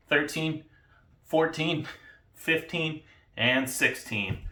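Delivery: counting reps mid push-up, straining; doing push ups. Real, not acted. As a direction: call each rep out while pushing up.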